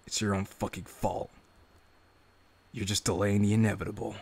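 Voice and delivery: low, soft grim reading; dark inner monologue. A narrator's reading. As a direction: read the self-talk as a low menacing inner voice.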